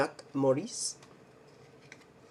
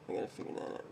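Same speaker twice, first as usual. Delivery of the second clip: hushed whisper, thinking aloud; a real whisper to himself while checking; whisper to self; 14 dB quieter, much faster than usual. Real, not acted. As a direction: whisper it under your breath. Muttering to himself mid-search.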